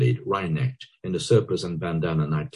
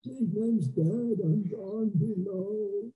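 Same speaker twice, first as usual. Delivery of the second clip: slow, soft and muffled; as if from under the ground; 7 dB quieter, much slower than usual. A narrator's reading. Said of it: "Dignam's voice muffled".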